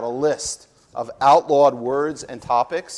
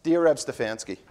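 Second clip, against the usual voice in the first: ordinary talk, nothing marked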